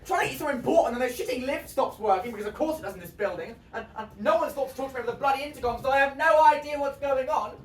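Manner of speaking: loud, high, agitated rant; frustrated rant. Acted. Trapped in the lift with a patient, frantic rant.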